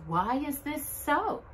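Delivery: usual speaking voice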